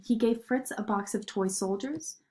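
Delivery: ordinary delivery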